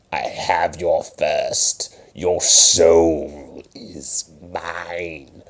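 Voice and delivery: slow, creepy villain whisper. Pretend. Comic claiming newcomers' firsts, slow creepy whisper. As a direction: hiss it like a soul-stealing demon. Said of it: "talking like this".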